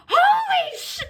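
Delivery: high-pitched mock outburst, cut short; retold interjection. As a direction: burst out high, cutting off the swear word. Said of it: "most likely heard me interjecting".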